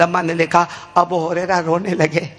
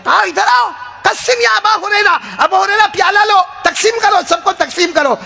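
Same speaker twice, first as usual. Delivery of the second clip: much higher, bright calling out; eager calling; 11 semitones higher than usual. Acted. The Prophet summons Abu Hurairah to share the milk, calling high. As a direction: beckon him over eagerly.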